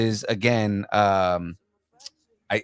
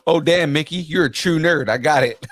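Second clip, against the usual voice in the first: higher, louder mock amazement; mock-impressed; 6 semitones higher, 4 dB louder than usual. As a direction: play an impressed onlooker. Quotes an imagined impressed listener.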